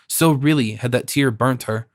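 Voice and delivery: ordinary delivery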